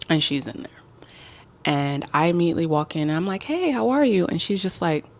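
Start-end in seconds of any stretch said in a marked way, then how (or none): 3.4-4.3 higher-pitched cheery retold hello; friendly greeting. Traveler walking into the hostel room, friendly greeting. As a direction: greet a new roommate with a high lilt.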